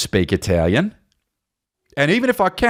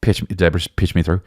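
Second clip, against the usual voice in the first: ordinary delivery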